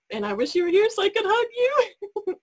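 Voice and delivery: crying. Real, not acted. Wishing her absent friend were there to hug, crying. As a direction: voice breaks high into real tears. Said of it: "I'm gonna get emotional".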